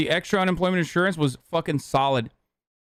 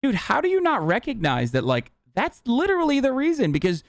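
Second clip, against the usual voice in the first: higher, rising disbelief at the owners; telling off owners in disbelief, higher and heated; exasperated rant; 6 semitones higher than usual. Retold or imitated. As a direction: push the pitch up in outrage. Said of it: "And I was like".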